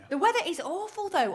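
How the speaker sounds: high-pitched complaint. Real, not acted. Co-host changing subject to the weather, high-pitched.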